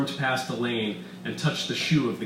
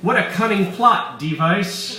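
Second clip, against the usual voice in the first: sarcastic exclaim; 9 semitones higher, 8 dB louder than usual. Acted. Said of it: "Elaine exclaimed".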